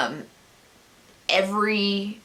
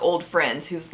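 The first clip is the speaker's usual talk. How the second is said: usual speaking voice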